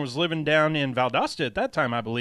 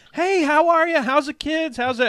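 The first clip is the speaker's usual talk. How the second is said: high, loud folksy Midwestern greeting; mock folksy Midwestern accent; 10 semitones higher, 4 dB louder than usual. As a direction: chirp it like a cheery Midwestern skier. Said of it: "they didn't say it in that accent".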